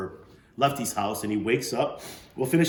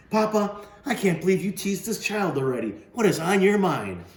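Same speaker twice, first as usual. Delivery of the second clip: scolding daughter; 10 semitones higher, 6 dB louder than usual. Acted. Daughter scolding her teasing father.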